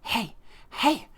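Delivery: high, short, repeated heys; insistent spirit voice. Retold or imitated. Unseen spirit calling a sleeper over and over, insistent. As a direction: imitate a voice nagging for attention. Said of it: "And I'm here, I'm thinking".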